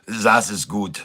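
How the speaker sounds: mock Norwegian accent, z for th; playful Norwegian salesman; light Norwegian accent put-on. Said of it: "when I talk like this".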